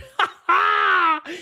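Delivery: high shouted laugh; burst of laughter. Real, not acted. Laughing at the stream.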